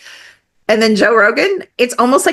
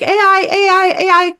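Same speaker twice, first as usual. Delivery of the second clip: higher, hyped-up parroting of everyone; mocking the crowd; 10 semitones higher than usual. Speaker mocking everyone's A I craze, higher and parroting. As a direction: parrot the hype. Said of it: "Everybody's like".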